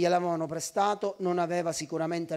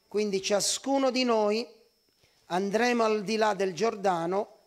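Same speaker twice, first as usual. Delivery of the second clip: raised, emphatic preaching of the scripture; preaching at full force; 3 dB louder than usual. Acted. Preacher rousing the church with the passage, emphatic preaching. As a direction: proclaim it to the congregation, punching each phrase.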